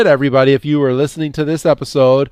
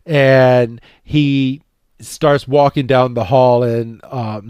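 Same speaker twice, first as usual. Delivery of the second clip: usual speaking voice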